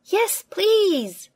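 put-on high, loud childish eagerness; eager yes please. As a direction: squeal it like children promised a treat. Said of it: "say Peppa and her friends".

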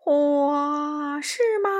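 long wail rising into a high question; howl, then question them spookily; drawn-out, high spooky doubt; ghost voice. A narrator's reading. The ghost answers the frightened friends.

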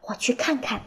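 hushed, secretive whisper; 悄悄低语. A narrator's reading. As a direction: whisper softly, volunteering to sneak a look. Mole volunteers in secret. (轻声耳语，悄悄地说)

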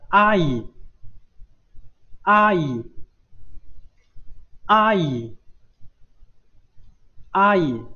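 teacher's clear 阿姨, again and again; repeat-after-me demo. Shown as a demonstration. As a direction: model 阿姨 for the class to echo. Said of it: "跟我读一下".

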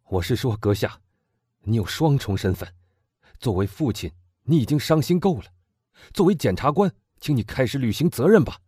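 put-on loud, high, fervent young man; impassioned young man. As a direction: demand revenge with burning passion. Morrel confronts prosecutor. (激昂的青年)

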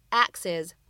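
"ex is" run together as one word "exes"; linking. Shown as a demonstration. Teacher demonstrating the linked "exes". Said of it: "我们可以读成".

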